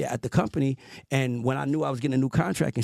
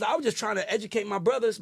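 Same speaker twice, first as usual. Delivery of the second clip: normal talking voice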